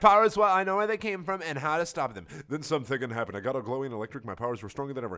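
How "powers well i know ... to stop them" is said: high loud gloating; mock villain gloat. [name] gloating at Marissa, high loud mock villain.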